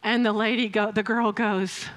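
ordinary delivery

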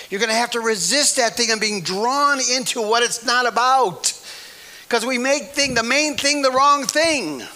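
loud, high fervent preaching; preaching at full force. Real, not acted. Preacher urging the church, loud fervent cry. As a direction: press the warning hard, voice up.

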